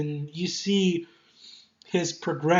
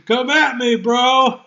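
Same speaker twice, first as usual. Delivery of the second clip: mock taunt; 6 semitones higher, 7 dB louder, slower than usual. Challenging enemies to a standoff.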